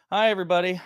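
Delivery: loud, high cheery hello; bright greeting. Real, not acted. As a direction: call it out to the whole room. Calling out a greeting.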